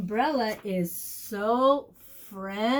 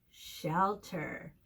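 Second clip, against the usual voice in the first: softer repeat; 6 semitones lower, 7 dB quieter than usual. Shown as a demonstration. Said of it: "here's our last one".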